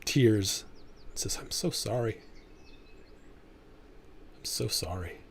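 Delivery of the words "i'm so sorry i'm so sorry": soft, understated acted grief; tearful apology. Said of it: "looks at you through tears".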